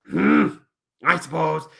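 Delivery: loud grumpy hmm, then reluctant assent; grudging agreement. Acted. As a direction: give in like a thwarted bear puppet. Puppet reluctantly gives in.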